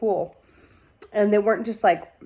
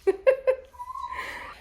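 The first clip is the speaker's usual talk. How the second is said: high giddy giggle. Real, not acted. Giddy over plot.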